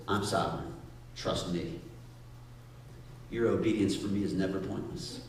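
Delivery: low, quiet divine reassurance; God's voice. Retold or imitated. God answering the doubter, low and assured.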